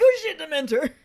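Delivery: a hammed-up startled cry; mock fright. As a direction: yelp it like a jump scare hit you. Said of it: "'Cause you're just like".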